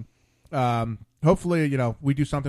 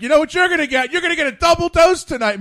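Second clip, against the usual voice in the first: put-on loud, high drunken boast; drunk Bob bragging; 13 semitones higher, 10 dB louder than usual. Bob boasting drunk to his wife, loud and high. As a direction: crow it out like a drunk husband teasing his wife. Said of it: "He's like".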